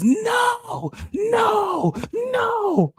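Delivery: very high, shouted protest; panicked subconscious. Retold or imitated. Subconscious mind protesting to the speaker, high panicked shout. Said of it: "my subconscious mind says".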